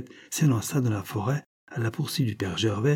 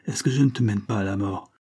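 plain reading voice

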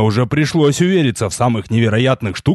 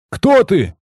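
shouting; 8 semitones higher than usual. A narrator's reading. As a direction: shout up at the tower. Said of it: "крикнул я".

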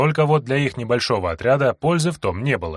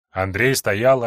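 plain reading voice

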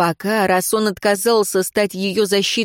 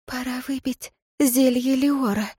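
soft, breathless and high; breathless whisper; 5 semitones higher, 4 dB quieter than usual. A narrator's reading. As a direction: whisper out of breath after a kiss. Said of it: "задыхаясь, прошептала Лейра Дорин".

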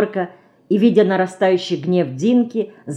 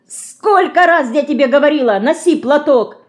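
higher, louder angry scolding; angry shout; 8 semitones higher, 4 dB louder than usual. A narrator's reading. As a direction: scold her sharply about the handkerchief. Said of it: "сердито закричала она".

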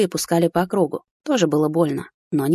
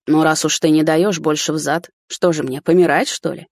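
usual speaking voice

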